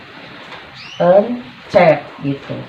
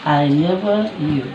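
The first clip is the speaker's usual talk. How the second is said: stretched out; slower than usual. Shown as a demonstration.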